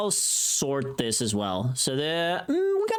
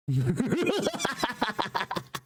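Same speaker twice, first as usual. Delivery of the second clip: giggle; 9 semitones higher than usual. Real, not acted. Reacts to her own hot take.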